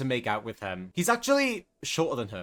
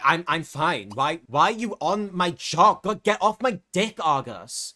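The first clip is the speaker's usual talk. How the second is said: defensive, louder, higher snap; irritated snap; 4 semitones higher, 5 dB louder than usual. Acted. Bianca snapping at Argus to stop worrying. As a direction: bite back to make him drop it. Bianca snaps at worried boyfriend.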